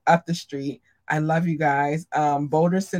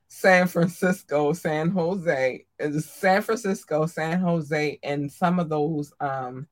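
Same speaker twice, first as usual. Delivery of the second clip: ordinary talk, nothing marked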